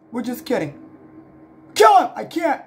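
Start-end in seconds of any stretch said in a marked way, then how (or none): 1.8-2.1 high, loud play-acted command; urgent mock-battle shout; shouted order. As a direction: yell the attack order. Ordering an attack in pretend fight.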